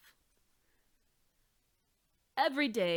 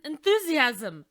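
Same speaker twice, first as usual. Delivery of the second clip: tactful hesitation; 6 semitones higher, 13 dB louder than usual. Acted. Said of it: "Maestro Provolone uttered".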